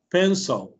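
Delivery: "Pencil" as PEN-suhl, very short neutral second vowel; schwa pronunciation. Shown as a demonstration. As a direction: model "Pencil" with the i reduced, nearly PEN-sol. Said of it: "es como una E bien corta".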